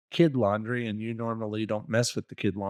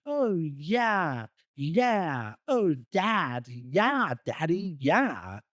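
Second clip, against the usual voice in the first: higher, hammed-up fake moans; mock porn moaning; 4 semitones higher, much slower than usual. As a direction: overact a porn star faking it. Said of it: "It's like porn".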